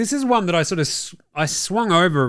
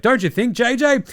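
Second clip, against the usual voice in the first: mock sweet commentator; 8 semitones higher, 3 dB louder than usual. Mocking overly nice commentators.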